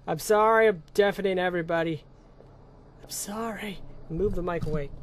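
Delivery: normal talking voice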